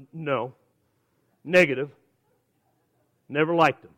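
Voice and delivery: low, quiet, dry rejection; deadpan refusal. Acted. Preacher turning down Brussels sprouts at the buffet, deadpan. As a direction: drop it down, short separate verdicts with long pauses.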